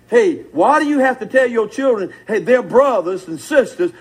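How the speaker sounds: normal talking voice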